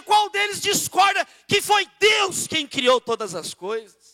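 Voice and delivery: shouting, slow and high, preaching at full force. Acted. Pastor calling the congregation to listen, loud fervent preaching. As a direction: thunder the call to hear God's voice, drawing each phrase out.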